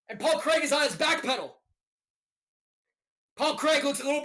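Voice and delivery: live fight calls, rising with the action; play-by-play commentary. Acted. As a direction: narrate the round like a ringside caller.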